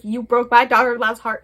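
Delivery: loud, indignant mock scolding; hammed-up mother telling him off. Mother berating her son over a broken heart, loud mock scolding.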